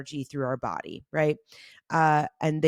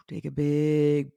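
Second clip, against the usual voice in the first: guided meditation. Acted. Leads a guided breathing meditation.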